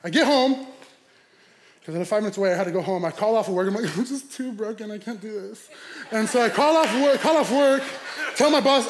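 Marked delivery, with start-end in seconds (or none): 4.0-5.7 soft, feeble whimper put on for laughs; mock broken. Driver calling off work, mock broken. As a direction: give up in a small voice.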